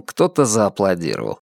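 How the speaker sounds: plain reading voice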